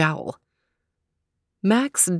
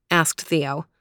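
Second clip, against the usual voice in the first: ordinary delivery, nothing marked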